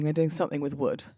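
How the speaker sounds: ordinary delivery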